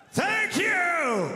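shouted thanks, ringing out; shouting. Real, not acted. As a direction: shout it to the crowd.

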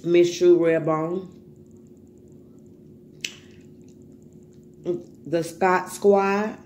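slow, drawn-out roll call; shout-out call. Real, not acted. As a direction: announce each name slowly, projecting. Calling out names in shout-outs.

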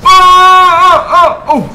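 high, loud startled cry; shocked shout. Real, not acted. Sudden in-game shock.

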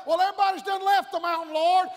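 high, fast, loud whining objection; loud protest. Acted. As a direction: argue back at God. Elijah voiced protesting.

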